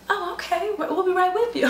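high-pitched, eager-to-please host; suddenly obliging host. Retold or imitated. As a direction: raise the pitch into sudden customer-service deference. Retold host impressed by surname.

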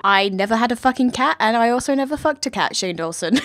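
loud, fast and emphatic; loud insistence. Real, not acted. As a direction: shout the denial for the camera. Emphatic denial about the cat.

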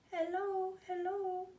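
high, small birdlike voice; a tiny high chirping baby bird; little bird voice. Acted.

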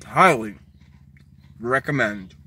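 chewing while talking; crunchy snack in mouth; mouth full of chips. Real, not acted. Seen 0: Chip goes into mouth at 3.7s, then he talks while chewing.

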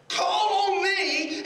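high, loud and drawn-out call; ringing proclamation. Acted. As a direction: stretch it out like a preacher proclaiming. Repeats God's words as proclamation.

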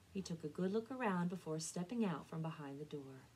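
ordinary delivery, nothing marked

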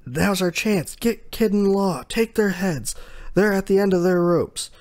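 rally the crew to strike now; eager pirate shout. Retold or imitated.

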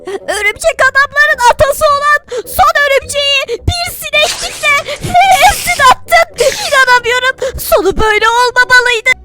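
high, hammed-up grieving wail; overplayed grief for a spider; mock lament. Spider-Man laments the dead spider.

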